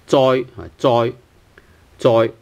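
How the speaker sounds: "Joy" said like tsoy; ts onset. Shown as a demonstration. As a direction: begin "Joy" with ts, lips relaxed. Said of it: "more like T S than J".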